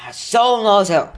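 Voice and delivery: loud, high start, settling down; proud. A narrator's reading. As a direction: boast it as Hagrid, chest out. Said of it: "said Hagrid prou-, proudly".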